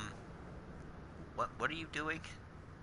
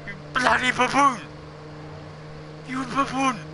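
angry yell; 9 semitones higher than usual. Real, not acted. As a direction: yell it at the driver. Yelling at another driver.

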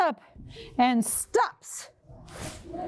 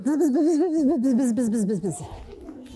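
bee sound; 3 semitones lower than usual. Shown as a demonstration. Leading kids in buzzing while they eat. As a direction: buzz while pretending to eat. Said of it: "make a little bee sound while you eat".

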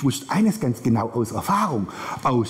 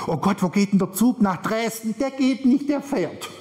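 raised-pitch fretting at the station; flustered traveler; 6 semitones higher than usual. Acted. Passenger asking station staff for the Dresden train, flustered. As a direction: play a flustered passenger getting corrected.

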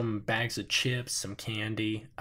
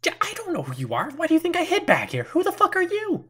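much higher, louder, fast and frantic; panicked shout; 7 dB louder, faster than usual. Acted. Hidden woman yells at the intruder in panic. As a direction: screech at the stranger in fright.

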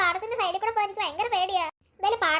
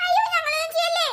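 frightened pleading; 7 semitones higher than usual. Acted. Girls beg the kidnapper.

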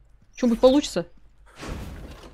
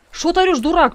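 higher mock scolding; 6 semitones higher than usual.

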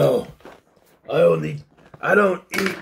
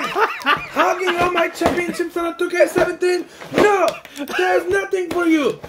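excited mock rant; 8 semitones higher than usual. Continues laughing mock rant.

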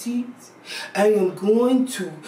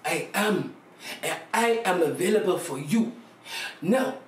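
ordinary talk, nothing marked

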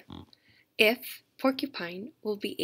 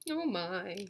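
ordinary talk

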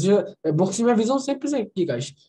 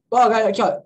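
ordinary delivery

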